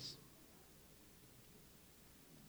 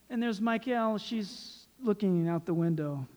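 ordinary talk

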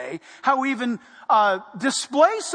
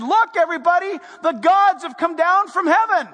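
raised, louder awestruck proclamation; amazed crowd; 6 semitones higher, 4 dB louder than usual. Acted. Crowd in Lystra hailing Paul and Barnabas as gods, amazed. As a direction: cry it out to everyone around. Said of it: "And they said".

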